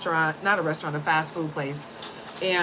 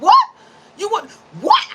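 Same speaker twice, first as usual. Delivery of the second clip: high, loud retold challenge; gone crazy; 11 semitones higher, 8 dB louder than usual. Woman re-enacting her clash with the cashier, going crazy. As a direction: fire it back high and loud, acting out the fury. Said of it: "I just went crazy".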